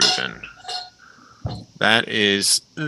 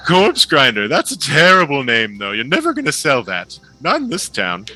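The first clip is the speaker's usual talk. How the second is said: flamboyant halfling; 8 semitones higher than usual. Acted. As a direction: gush like a delighted stage impresario. GM voices the halfling Mallow.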